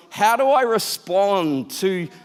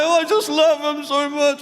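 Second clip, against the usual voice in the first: mock crying; 8 semitones higher than usual. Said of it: "And I started crying".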